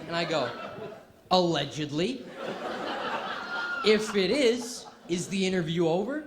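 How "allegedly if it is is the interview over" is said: louder put-on Boston accent, stretched out; Will from Good Will Hunting. Caught-out student to his interviewer, Boston accent. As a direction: dodge the question in a Boston accent. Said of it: "I become Will from Good Will Hunting".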